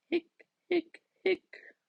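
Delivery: little monster hiccuping; tiny hiccups. Acted. Stella's hiccups.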